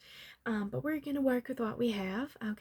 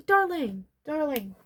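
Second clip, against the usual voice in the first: high, loud call that eases off; gushing call-out; 5 semitones higher, 6 dB louder than usual. Pretend. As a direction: call someone over to admire her. Calling someone to admire the client.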